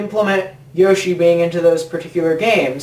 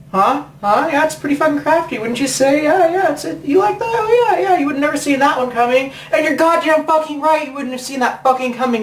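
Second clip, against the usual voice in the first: sarcastic taunt; 7 semitones higher than usual. Acted. Sarcastic taunting of Nintendo idea.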